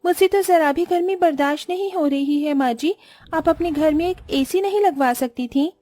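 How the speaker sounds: high-pitched, fussy whine about the heat; entitled daughter-in-law complaining. Acted. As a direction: play a pampered young bride griping at her mother-in-law. (नखरीली बहू की ऊँची, शिकायती आवाज़)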